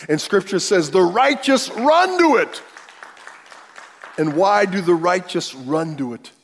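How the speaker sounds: loud, building sermon climax; preaching at full force. Acted. Preacher rallying the congregation that God is the strong tower, loud. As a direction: proclaim it with full power.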